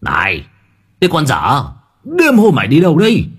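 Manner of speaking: put-on old man's scolding call, rising high. A narrator's reading. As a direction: call out crossly after someone leaving. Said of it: "ông cụ Tính gọi".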